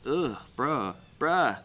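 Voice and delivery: ordinary delivery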